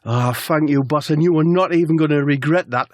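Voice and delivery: put-on voice drifting Geordie; accidental Geordie. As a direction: thank the boss with a Geordie slip. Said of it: "And Wiltshire's not Geordie".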